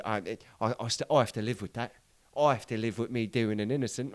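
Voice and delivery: quiet, low Cockney confession; imitating old gangster. Old gangster admitting the killing, quiet and low. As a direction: mumble the regret like an old crook. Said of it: "that's how he said".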